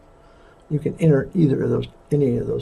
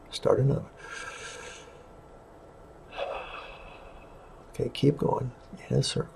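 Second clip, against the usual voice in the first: soft, fading into a whisper; guided meditation; 4 dB quieter than usual. Acted. Leads a breathing meditation.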